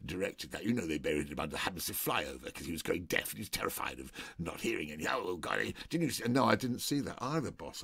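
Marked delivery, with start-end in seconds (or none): 0.0-6.2 lightly voiced drunk star, dipping lower on the names; a hint of a pompous actor; boastful film star. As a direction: brag about your old films. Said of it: "And he'd go".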